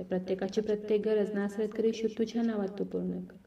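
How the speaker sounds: hushed intercession, addressed to God; heartfelt prayer. Real, not acted. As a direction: pray earnestly, softening as you plead. Leads a closing prayer.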